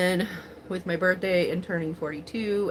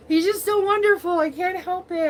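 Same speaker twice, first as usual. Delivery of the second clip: higher and louder, emotion welling up; getting teary-eyed; 9 semitones higher, 5 dB louder than usual. Real, not acted. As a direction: overcome with real gratitude, close to tears. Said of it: "Like, I'm getting teary-eyed".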